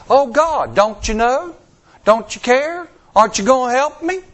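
high, loud accusing plea; accusing whiny prayer. Retold or imitated. Pray-er accusing God, high loud complaint. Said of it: "that's an accusation".

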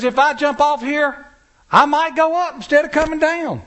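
mock holler. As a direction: imitate a man hollering from a ledge. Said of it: "he hollers back at me".

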